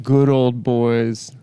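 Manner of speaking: ordinary talk, nothing marked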